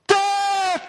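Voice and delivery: very high, loud war shout; rally the cavalry with one cry; battle cry. Retold or imitated. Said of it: "And they all go".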